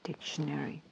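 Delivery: unvoiced whisper; whispered aside. Real, not acted. She whispers to herself while looking up the word, unvoiced. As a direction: whisper it to yourself, hushed.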